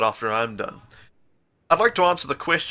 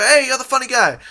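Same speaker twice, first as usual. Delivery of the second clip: higher, louder mock customer greeting; mock excited customer, higher and louder; imitating a customer; 11 semitones higher, 7 dB louder than usual. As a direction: call out like an eager shopper spotting him. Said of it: "customers come and be like".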